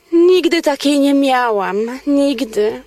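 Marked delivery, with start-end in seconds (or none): none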